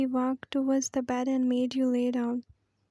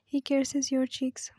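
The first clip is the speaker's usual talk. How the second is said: ordinary delivery, nothing marked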